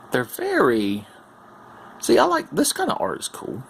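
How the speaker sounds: normal talking voice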